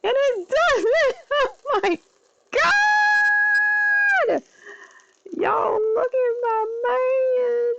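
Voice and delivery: very high, drawn-out squeal; scream-squeal with pure excitement; real fangirling. Real, not acted. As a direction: ecstatic fan shriek, stretched out. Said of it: "I am so excited".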